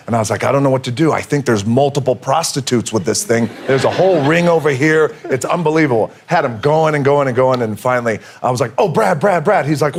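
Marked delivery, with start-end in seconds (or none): 0.4-6.1 mock alarm, pitched higher; feigned panic. Prankster winding up his agent with a fake scandal, higher-pitched mock panic. 8.8-9.6 high, repeated name to cut in; urgent calling. Retold or imitated. Prankster stopping his agent before the reveal, high mock-urgent call.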